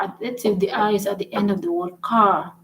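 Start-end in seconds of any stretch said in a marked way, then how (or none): none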